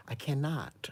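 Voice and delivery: breathy whisper of disbelief. Retold or imitated. Watching a bad wig video, a hushed whisper. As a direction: whisper it like you can't bear to look.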